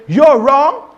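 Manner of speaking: loud, high "You're wrong,"; blunt rude retort. Shown as a demonstration. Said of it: "instead of just saying".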